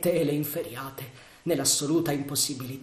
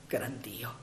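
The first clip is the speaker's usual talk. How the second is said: whispered dismay; 3 semitones lower, 11 dB quieter than usual. Acted. Hearing the warning whistle at night.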